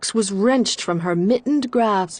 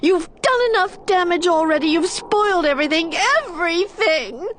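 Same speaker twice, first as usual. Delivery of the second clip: high, fast angry reproach; scolding; 11 semitones higher, faster than usual. A narrator's reading. Injured Irene blaming the wind, high fast scolding. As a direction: rail at the wind. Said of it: "she scolded".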